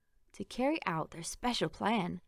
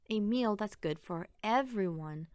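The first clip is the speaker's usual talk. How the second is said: usual speaking voice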